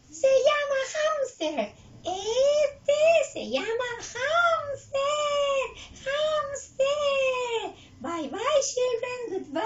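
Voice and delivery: high, squealing puppet voice; slow, loud cartoon repetition with long stretched vowels; Chuy. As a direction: parrot the word like an eager little character. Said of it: "A ver, repite, Chuy".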